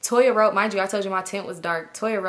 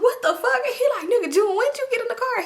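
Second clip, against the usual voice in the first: startled yelp; 3 dB louder than usual. Retold or imitated. Friend startled by hidden passenger.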